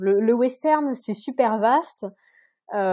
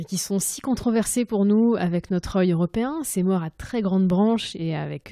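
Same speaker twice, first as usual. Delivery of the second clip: ordinary talk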